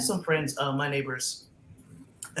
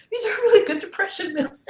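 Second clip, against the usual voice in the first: squeaky, much higher and louder repeat; high, loud playful voice on the repeat; high put-on voice; 6 dB louder than usual. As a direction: jump way up in pitch for the punchline. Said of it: "I'm like".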